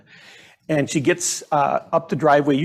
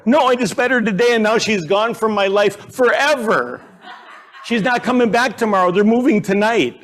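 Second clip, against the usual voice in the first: higher-pitched retold child's lament; distraught little girl; 6 semitones higher than usual. Daughter tells dad her new friend is leaving, mock-distraught. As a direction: imitate an upset five-year-old pouring it out. Heartbroken five-year-old voiced in story.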